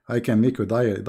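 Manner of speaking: ordinary delivery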